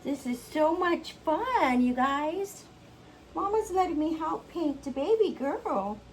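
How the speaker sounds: put-on high, singsong kid voice; delighted little child. Child telling viewers she is helping Mama, delighted little-kid voice. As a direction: chirp to the viewers like a small kid.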